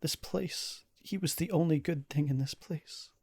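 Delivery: pleading, sinking toward a whisper; crumpled in sorrow. Acted. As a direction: mourn in halting phrases with long pauses. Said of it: "crumples into just a ball of sorrow".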